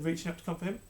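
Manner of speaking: usual speaking voice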